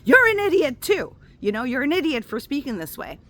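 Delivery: loud, high retort thrown right back; yelling back. Retold or imitated. She snaps back at the angry driver, loud high retort. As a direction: shout it back at him, unbothered. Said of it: "I'm like".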